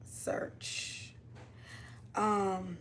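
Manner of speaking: very soft, muttering each word while typing; murmured to herself. Real, not acted. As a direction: mumble the search words under your breath.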